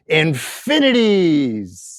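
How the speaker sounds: long drawn-out word, imitating a little boy; slow, gleeful child's exaggeration; imitated excited kid. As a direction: stretch it out like a kid boasting of endless love. Voicing his small son's huge answer.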